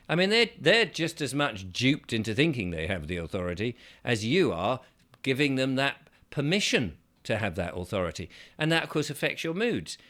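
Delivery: normal talking voice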